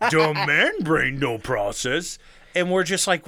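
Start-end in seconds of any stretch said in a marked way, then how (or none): 0.1-2.2 slow, dim-witted caveman delivery; mock dumb man. Clueless man failing to understand, slow mock-dumb voice. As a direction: drag it out like a brain stalling. Playing his own clueless male brain.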